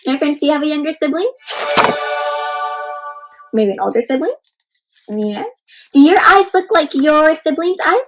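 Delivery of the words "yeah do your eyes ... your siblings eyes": ordinary delivery, nothing marked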